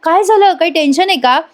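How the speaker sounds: retold worry, pitch lifted in urgent concern; tense, worried. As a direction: act out an anxious man asking what happened. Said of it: "राजाला येतं tension".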